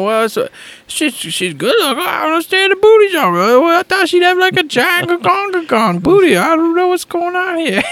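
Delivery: high, hammed-up confused babbling; mock bewilderment. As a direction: whine in baffled protest, reenacting the night. Acts out confused drunken booty rant.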